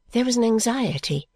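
usual speaking voice

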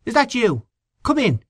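high, eager welcoming voice; eager host. A narrator's reading. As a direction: welcome him in warmly.

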